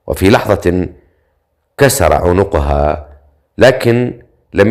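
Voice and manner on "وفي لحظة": ordinary delivery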